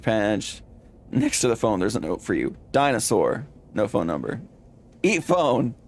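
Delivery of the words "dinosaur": ordinary talk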